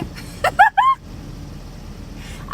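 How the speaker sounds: very high, loud squeal of joy; overjoyed squeal. Real, not acted. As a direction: squeal with delight. Overjoyed at the working truck.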